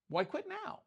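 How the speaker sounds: squeaky high "Why quit now?" in a soft voice; high mocking question. Preacher teasing Peter about quitting, high mock squeak. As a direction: tease Peter with a high, thin voice. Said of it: "Why'd you quit now?".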